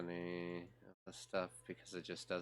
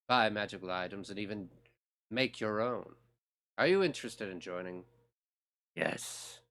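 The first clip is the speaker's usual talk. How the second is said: guild master voice; 8 dB louder than usual. Acted. Reads the Mages Guild recruiter's lines.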